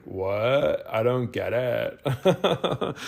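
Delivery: put-on drawn-out Valley Girl slowly, with a laugh; baffled Valley Girl drawl, laughing; Valley Girl accent. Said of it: "Doing my Valley, Valley Girl accent".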